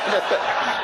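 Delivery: short breathy chuckle; a quick laugh. Real, not acted. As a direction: chuckle at the idea. Preacher laughing at own joke.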